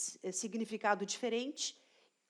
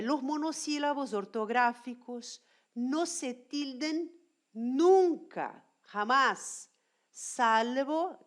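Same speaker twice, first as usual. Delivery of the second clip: reading rule aloud; 2 semitones higher, 5 dB louder, slower than usual. Acted. Reads RAE rule.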